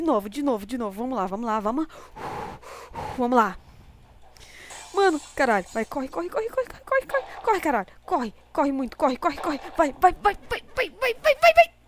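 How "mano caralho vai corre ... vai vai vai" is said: high, frantic yelling; being chased. Real, not acted. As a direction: urge yourself on in panic. Fleeing monsters again.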